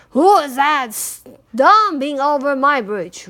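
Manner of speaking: loud troll challenge; troll's roar. A narrator's reading. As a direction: roar at whoever is on the bridge. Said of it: "roared the troll".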